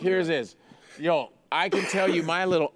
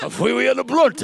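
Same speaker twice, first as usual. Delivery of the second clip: much higher, laughing put-on Japanese accent; mock Japanese accent; 9 semitones higher, 6 dB louder than usual. Mocking a friend's order in a laughing Japanese accent. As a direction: squeal it in a hammed-up Japanese accent. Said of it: "you keep being Japanese".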